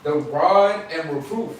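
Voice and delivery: booming Bible verse read aloud; loud scripture reading. Real, not acted. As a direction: read it out like from a pulpit.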